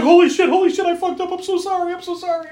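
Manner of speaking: high, frantic, hammed-up panic; panicked apology. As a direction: squeal the apology like a guy who just shot someone.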